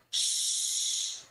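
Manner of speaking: drawn-out "shh" of pure breath; long hushing shush. Acted. The peacock silences the admiring crow, long hushing shush. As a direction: hush them with a long shh.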